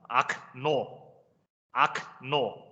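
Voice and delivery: Okno said slowly as ak-NO, two separate syllables; unstressed O as A. Shown as a demonstration. Teacher modelling Russian window, Okno as ak-NO. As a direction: say Okno as a short ak, then a full no. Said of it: "第一個O咧係讀".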